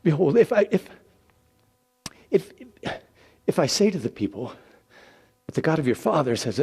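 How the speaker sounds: high, loud, halting Moses voice; anxious stammer. Acted. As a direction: stumble over the words in panic.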